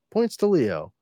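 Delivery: ordinary talk, nothing marked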